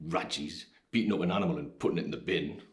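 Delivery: normal talking voice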